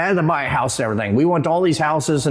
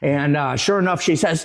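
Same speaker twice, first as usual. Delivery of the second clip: normal talking voice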